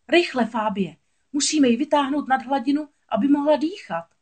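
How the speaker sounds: high, alarmed cry for help; urgent calling out. A narrator's reading. Crab urging a friend to rescue Ariel, urgent. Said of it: "zvolal Sebastián".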